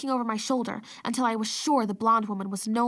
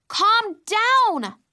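high, loud frantic shout; 11 semitones higher, 8 dB louder than usual. A narrator's reading. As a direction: shout her down. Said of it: "I shouted".

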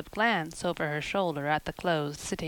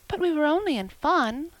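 persisting; 9 semitones higher, 4 dB louder than usual. Acted. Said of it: "persisted Anne".

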